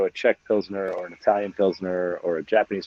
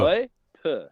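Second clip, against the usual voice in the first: higher mimicked app voice, syllables apart; imitating Google Translate; 5 semitones higher than usual. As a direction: play the pronunciation app, a little high. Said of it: "I love the way it's like".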